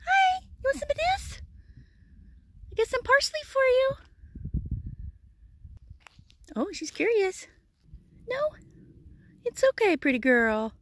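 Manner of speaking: high, cooing pet voice offering parsley to a curious animal; pet talk. Real, not acted. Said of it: "This is how I talk to all animals".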